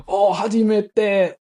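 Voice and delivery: high, loud, drawn-out delight; slow, stretched exclamations bursting with enthusiasm; imitated excitement. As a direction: imitate him thrilled for you. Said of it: "he got so excited".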